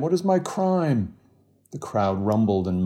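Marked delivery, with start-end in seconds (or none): 0.0-1.1 high, pleading repeat; cried out again. A narrator's reading. Bloodied man repeating his plea to the crowd, pleading cry. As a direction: ask it again, still pleading. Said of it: "as he cried".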